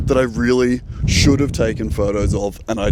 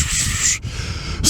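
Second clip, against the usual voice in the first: soft breathy wind noise; imitated wind whoosh; 5 dB quieter than usual. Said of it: "you're just gonna hear whoosh".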